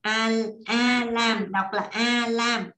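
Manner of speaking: "A Lam" drawn out syllable by syllable; teacher spelling out "A Lam" slowly for the class; slow pronunciation demo. Shown as a demonstration. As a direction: say "A Lam" very slowly, each sound apart. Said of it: "mình sẽ đọc thành chữ L".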